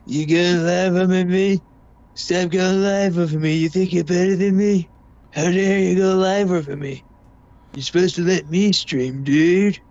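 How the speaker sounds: whiny mock rant at a rival streamer; mocking Tony. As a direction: lightly imitate a sore streamer complaining. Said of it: "they'd be like".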